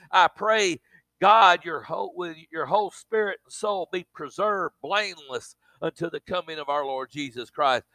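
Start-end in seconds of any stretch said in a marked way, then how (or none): none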